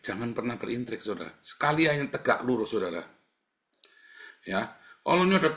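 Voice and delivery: usual speaking voice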